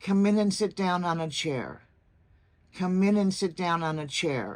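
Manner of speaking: "Come in and sit down on a chair" flowing together; smooth, connected phrase ending down on "chair"; linked words. Shown as a demonstration. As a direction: link every word from "Come in" through "chair". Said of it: "linking makes language sound so much smoother".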